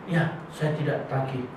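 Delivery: timid soft yes to the pastor; meek mumbled reply. Retold or imitated. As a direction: answer like a cowed churchgoer. Meek man gives in.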